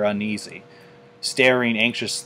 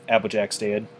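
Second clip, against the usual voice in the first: plain reading voice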